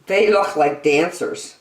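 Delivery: bright, lilting picture-book read-aloud; reading to children. Acted. Reading an animal picture book to young children, bright and lilting. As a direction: read it like a bedtime nature book for kids.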